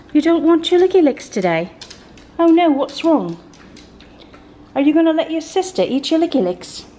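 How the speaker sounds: sing-song voice for pets; pet talk. Real, not acted. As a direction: coo at the dogs like babies. Baby talk to her pets.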